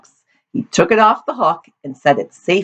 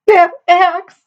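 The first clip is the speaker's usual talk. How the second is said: high-pitched, louder wail through sobs; sobbing; 5 dB louder than usual. A narrator's reading. Weeping daughter names what upsets her, sobbing. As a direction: sob it out in a very high voice. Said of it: "sobbed Amity".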